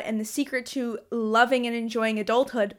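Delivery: usual speaking voice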